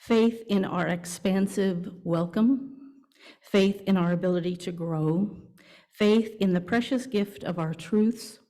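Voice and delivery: ordinary talk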